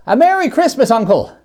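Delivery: high, loud festive call of good cheer; cheery greeting. Acted. Nephew bursting in to greet his uncle, a high loud cheerful call. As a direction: burst in brightly calling out the greeting. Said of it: "it was the voice of Scrooge's nephew".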